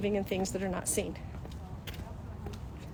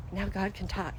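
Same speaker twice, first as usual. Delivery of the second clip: normal talking voice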